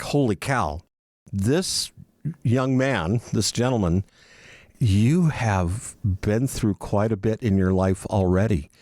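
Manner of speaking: ordinary talk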